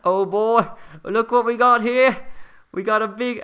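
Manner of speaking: high, loud, hammed-up Aussie wildlife host; terrible Australian accent. Livestream host spoofing a nature show about a falcon, terrible Australian accent. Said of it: "my terrible Australian accent".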